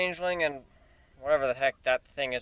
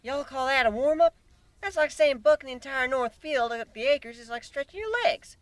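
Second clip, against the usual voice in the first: Southern farm pony; 9 semitones higher than usual. Acted. Applejack mocking the "warm-up", Southern accent. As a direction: scoff in a country accent. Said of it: "Applejack frowned".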